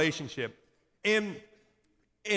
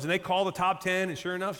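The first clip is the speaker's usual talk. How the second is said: ordinary talk, nothing marked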